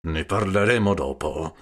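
grudging consent to Don Camillo; through gritted teeth. A narrator's reading. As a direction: grit your teeth on it. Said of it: "a denti stretti".

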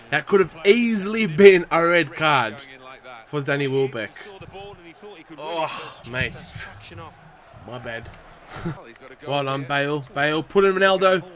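usual speaking voice

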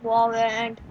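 low-pitched opening call; starting low before building up; low callout. Real, not acted. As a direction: call it low and held back. First of escalating repeated calls.

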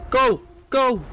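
loud, frightened urging; desperate to get away; urgent shout. Real, not acted. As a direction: yell it in short, panicked bursts. Urging escape in scary game.

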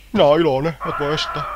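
gleeful creature. Acted. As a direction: burst out with cartoon joy. Fröhliches Monster verkündet Freude.

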